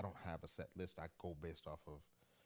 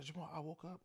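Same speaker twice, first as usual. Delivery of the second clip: mom voice pitched much higher; imitating worried mom; 10 semitones higher than usual. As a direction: play his worried mother, voice up high. Son retells mom's early call.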